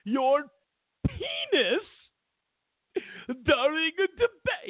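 high, slow, whiny clutching-pearls voice; shrill mock horror at a crude joke; mock outraged prude. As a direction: wail it like a scandalized prude. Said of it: "They're gonna go".

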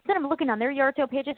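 usual speaking voice

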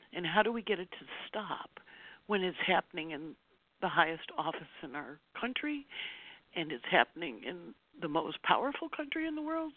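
tearful, high-pitched pleading; crying. Real, not acted. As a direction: ask it through real tears. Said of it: "which I'm doing now".

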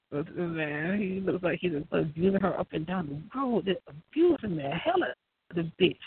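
normal talking voice

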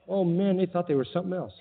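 normal talking voice

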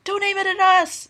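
high, loud shriek of alarm; mother shrieking at the bottle pointed at her; alarmed cry. Acted. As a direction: cry out so he won't point it at them. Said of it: "Melanie cried".

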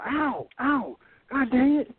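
hurt and annoyed at once; pained curse. Retold or imitated. Michael struck by a rock, high pained curse.

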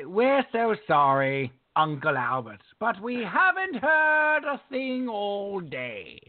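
slow, high, mock-posh British sing-song; slow British crooning parody. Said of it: "it's just so British".

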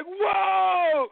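high, loud amazed whoa. Retold or imitated. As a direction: let the whoa jump up in amazement. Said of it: "And I was like".

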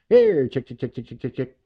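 sing-song call to the chickens, loud start. Retold or imitated. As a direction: call the chicks over to feed. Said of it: "I'm out there going".

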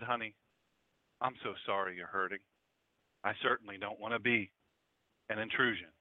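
low, gentle apology; a quiet old lady soothing someone who is hurting; soft and quiet. Acted. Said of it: "real soft and quiet, like she talks".

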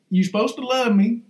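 ordinary talk, nothing marked

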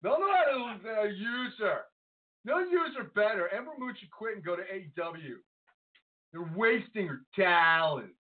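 high, petulant fan complaint; whiny internet fan. Acted. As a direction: gripe like an outraged online wrestling nerd. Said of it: "can I be like the I W C".

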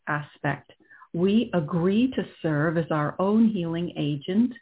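calm and unhurried, guiding inward; right in your ear. Acted. Leads a guided meditation.

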